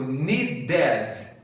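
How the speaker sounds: "needed" slowly with a full open, stressed ED; wrong "ed" sound. Shown as a demonstration. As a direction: hit the second beat of "needed" like "dead". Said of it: "o som não é ed". ("needed" errado, com "ED" aberto e forte)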